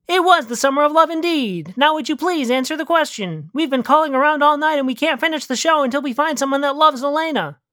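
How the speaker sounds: lightly voiced insistent host; game show host. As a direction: press for an answer as a radio host. Plays radio game show host.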